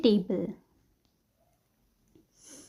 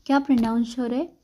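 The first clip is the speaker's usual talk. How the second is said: ordinary delivery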